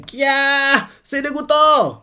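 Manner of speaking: very high squeal of disgust; disgusted shriek. Retold or imitated. Someone grossed out by the bug, very high shriek. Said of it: "Quelqu'un crie comme ça".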